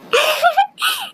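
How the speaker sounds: giggle, caught out; playful laugh. Acted. Playful banter with beautiful.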